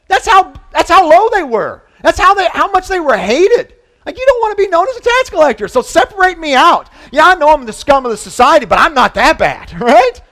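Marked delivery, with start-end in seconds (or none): 4.1-10.2 mock tax collector. Tax collector voiced self-deprecatingly.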